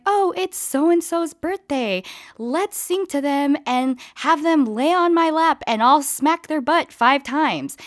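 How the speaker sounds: high, slow mock kindergarten teacher; sing-song teacher voice. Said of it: "the kindergarten teacher would be like".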